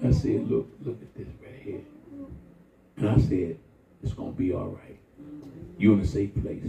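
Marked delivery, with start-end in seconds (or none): 0.5-1.8 put-on soft whisper to a child; hushed. Grandpa quietly showing the child. 4.0-6.6 softer, lower reassurance; calm her down gently; soothing. Retold or imitated. Grandpa soothing the frightened child.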